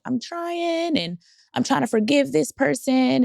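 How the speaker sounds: high, drawn-out mock whine; retold tearful plea. Telling her friend she's trying to forgive, imitated tearful plea. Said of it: "start crying and I'm like".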